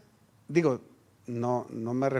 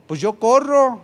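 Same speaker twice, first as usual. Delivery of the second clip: pitched up and loud, proud he can run; defiant boast; 7 semitones higher, 8 dB louder than usual. Retold or imitated. Father insists to his son he can run, in a loud boastful voice. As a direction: announce it like a challenge.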